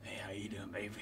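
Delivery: breathy low whisper; seductive whisper. Acted. Streamer hitting on an in-game character, whispered. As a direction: whisper it close and suggestive.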